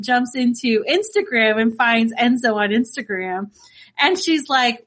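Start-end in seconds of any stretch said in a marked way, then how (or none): none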